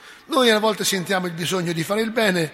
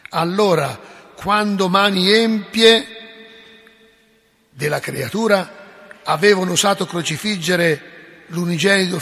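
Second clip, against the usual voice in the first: rising sermon cadence, louder on each allora; fiery preaching; 5 dB louder than usual. Acted. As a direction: preach it, building with every allora. Preaching build-up.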